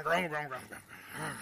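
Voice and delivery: low grumble trailing into nothing; soft, sinking imitation of grumbling; background murmur. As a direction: mutter it like discontent at the back of the room. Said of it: "that little murmur in the background".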